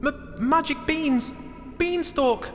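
high falsetto, stammered and stretched out; mock yell from high up; panicked calling out. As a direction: shout slowly down in a small squeaky voice. Jack calls down in panic.

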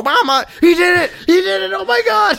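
very high, thrilled squeal; hammed-up joyful shrieking; ecstatic bird owner. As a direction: celebrate as if the bird finally spoke. Acting bird's success, owner thrilled.

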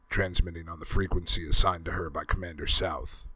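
ordinary delivery, nothing marked

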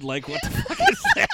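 high laughing squeal; incredulous laughing shriek; laughing disbelief. Real, not acted. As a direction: crack up while asking. Host reacts laughing to voice.

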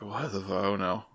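muted aside of quiet horror; stone-faced dismay. Retold or imitated. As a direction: trail off softly, barely reacting. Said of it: "kinda, like, stone-faced".

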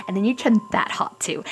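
conspiratorial whisper. Acted. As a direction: hush it like a prank.

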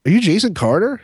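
high, rising starstruck question; tentative recognition. Retold or imitated. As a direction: ask as if you barely dare believe it. Retells recognising a TV celebrity.

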